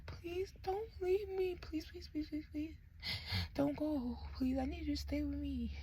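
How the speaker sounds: high, tiny, breath-thin begging; acted-out frightened whimper, very soft; whispered pleading. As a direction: beg someone desperately not to leave you. Acts begging nurse not to leave.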